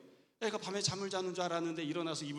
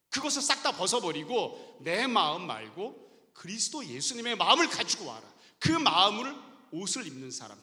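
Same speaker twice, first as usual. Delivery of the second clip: normal talking voice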